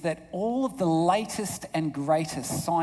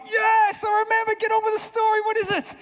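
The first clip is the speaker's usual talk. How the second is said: very high, exasperated mock shout; impatient father; 4 dB louder than usual. Grumpy dad telling his daughter to get on with it, impatient. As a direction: hurry a rambling child along at full pitch.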